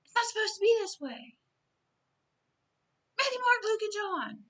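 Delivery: high, loud desperate cry; pleading scream. Retold or imitated. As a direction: scream it out as a warning from above. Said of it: "He was the voice that was screaming".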